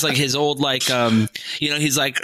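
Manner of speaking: ordinary talk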